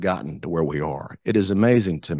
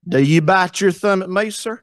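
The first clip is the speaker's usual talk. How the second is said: high, loud Appalachian challenge; Appalachian Shakespeare quarrel, pitched up; Appalachian accent; 10 semitones higher, 4 dB louder than usual. Shown as a demonstration. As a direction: pick a fight in a country Appalachian voice. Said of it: "country Appalachian accent".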